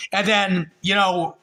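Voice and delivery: ordinary talk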